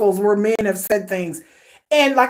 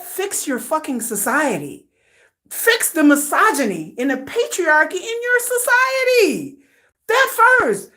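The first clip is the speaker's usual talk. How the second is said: pitched-up, emphatic indignation; indignant rant; 4 semitones higher than usual. Real, not acted. Fed-up vlogger tells society what to fix, indignant. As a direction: demand it, voice rising high with outrage.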